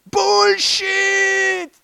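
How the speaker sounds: a high, loud cry of "bullshit!" as if winning; mock bingo shout. Presenter calling out a mock bingo win, high and loud. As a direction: belt it out like a winning bingo call.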